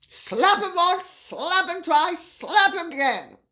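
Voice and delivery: loud, high, rhythmic mock orders; mock commands. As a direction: hit each slap hard, like a playful drill. Acting out commands in quoted message.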